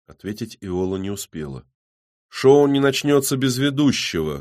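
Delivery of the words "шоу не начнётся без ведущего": raised, higher voice; loud announcement. A narrator's reading. Man steps out from behind the trees, announcing loudly. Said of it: "громко объяснил".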